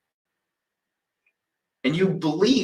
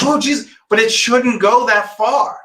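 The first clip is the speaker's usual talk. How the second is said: higher, mildly exasperated imitation; mock exasperated quote; 7 semitones higher, 3 dB louder than usual. Mocking the student's reluctance, higher and exasperated. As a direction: whine it like a cornered student. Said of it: "And you were like".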